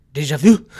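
déjà vu suddenly in an exaggerated French accent; hammed-up French on déjà vu. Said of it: "have to be so French about it".

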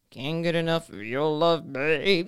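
put-on low crooner voice.

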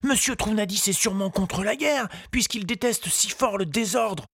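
put-on high, polite little boy's voice; a small boy asking politely; young boy Tistou. A narrator's reading. Tistou asks the gardener, high child voice.

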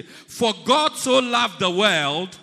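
loud recitation. Shown as a demonstration. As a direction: shout it so the back hears. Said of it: "encourage them to say".

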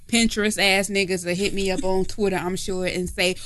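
usual speaking voice

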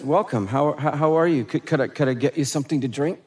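soft, low welcoming host, almost hushed; quiet hospitable welcome; gentle host voice. Acted. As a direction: greet them softly like a kind waiter. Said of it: "And he says".

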